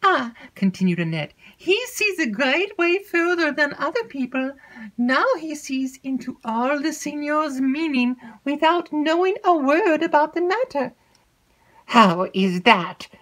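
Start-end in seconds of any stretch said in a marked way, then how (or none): 0.0-0.3 put-on high, breathless chatter of an excitable young maid; alarmed lady's maid spilling gossip. Annette resumes. 1.5-10.9 put-on high, breathless chatter of an excitable young maid; alarmed lady's maid spilling gossip. Annette praises Ludovico. 11.8-13.1 slow, louder demand from the mistress; pointed question. Acted.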